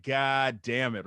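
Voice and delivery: slow, drawn-out dismay; exasperated groaning curse. Retold or imitated. Comic learning her family heard the joke, slow exasperation. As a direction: stretch each word out in sinking exasperation. Retelling his dismay at the news.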